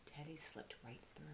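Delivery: breathy secret hush; soft storyteller whisper. A narrator's reading. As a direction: whisper it as he sneaks through. Sneaking through the raspberries.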